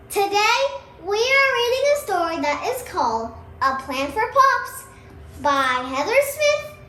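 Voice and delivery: high, loud host welcome; cheerful kid presenter opening the show; upbeat channel intro. Acted. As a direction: greet the viewers like a bubbly show host. Host opens the video.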